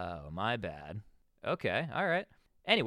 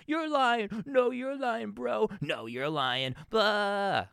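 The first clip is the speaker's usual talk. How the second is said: mock bickering, pitched way up; mocking yelling people; 9 semitones higher, slower than usual. Mocking people on TV yelling at each other, pitched up high. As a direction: parody two people shouting "you're lying" at each other. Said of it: "People just yelling at each other".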